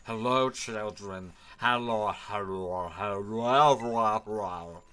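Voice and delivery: creepy broken-playback drag; lagging, glitching voice. Acted. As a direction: repeat like a malfunctioning professor. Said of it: "he's lagging super heavily and all of his frames are dropping".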